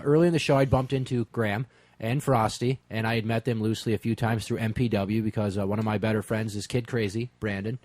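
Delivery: usual speaking voice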